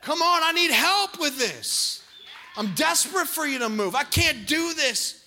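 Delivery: loud, high pleading shout for help; desperate cry to Jesus. Acted. Believer crying out to Jesus for help, desperate loud shout. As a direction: cry out like you can't hold it alone. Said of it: "our response should be".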